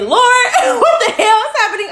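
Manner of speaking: high, loud laughing exclamation; laughing at herself. Real, not acted. Host catching her date mistake, laughing at herself. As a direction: laugh at your own mix-up. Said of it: "Not next Monday".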